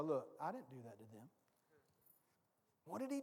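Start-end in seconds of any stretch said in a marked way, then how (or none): 0.0-1.3 much lower, much softer and quicker; a hushed low reassurance from Jesus; gentle Jesus. Retold or imitated. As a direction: say it close, a hand on his shoulder. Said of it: "Jesus puts his hand on my shoulder and go".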